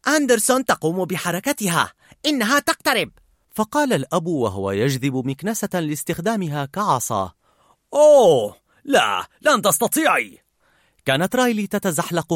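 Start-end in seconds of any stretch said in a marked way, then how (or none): 0.1-3.1 high, mock sports commentary; merry play-by-play, pitch climbing; playful. A narrator's reading. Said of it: "وقالت بمرح". 7.9-10.4 loud, raised mock protest; big drawn oh, mock alarm; playful challenge. Father playfully challenges in game.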